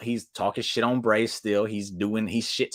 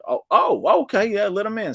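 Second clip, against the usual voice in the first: sudden change of heart; 12 semitones higher, 5 dB louder than usual. Retold or imitated. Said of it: "They're like".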